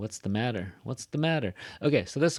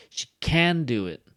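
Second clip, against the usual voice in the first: CAN punched loud and high; wrongly stressed can; 6 semitones higher, 8 dB louder than usual. Shown as a demonstration. Teacher showing the wrong, misleading stress on can. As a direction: overstress can like the negative. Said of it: "if you don't say it right".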